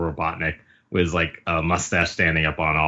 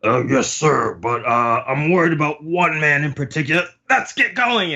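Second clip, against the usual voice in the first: pitched-up hesitant reply, then loud cut-off; worried underling; 7 semitones higher, 4 dB louder than usual. Acted. Babanuki raises a worry with Queen, pitched-up acted reply. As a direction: start hedging, climb to a high urgent finish.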